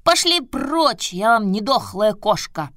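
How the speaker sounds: high, slow shout at the pests; angry boy voice. Acted. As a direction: shoo them off, high and sharp. Buratino shouts at pond creatures.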